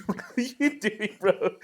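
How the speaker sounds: high, drawn-out question through laughter; laughing disbelief. Retold or imitated. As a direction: crack up while asking in disbelief. Incredulous retort amid laughter.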